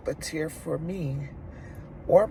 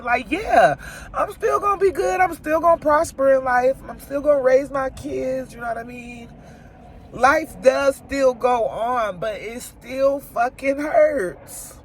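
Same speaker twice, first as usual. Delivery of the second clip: weeping yet defiant, much higher and louder; crying defiance; 8 semitones higher, 4 dB louder than usual. Real, not acted. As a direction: push through the sobs, voice up high. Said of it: "being vulnerable and crying".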